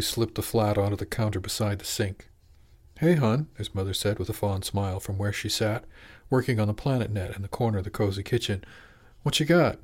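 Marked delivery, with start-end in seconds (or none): 3.0-3.5 mother's voice. Acted. Mother greets her son. 9.2-9.8 mother's voice. Acted. Mother asks her son.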